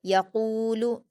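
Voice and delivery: यकूलु with a long, full कू; stretched, full qaf. Shown as a demonstration. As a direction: hold कू in यकूलु, short य and लु. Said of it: "दो हरकत खींचकर पुर पढ़ेंगे".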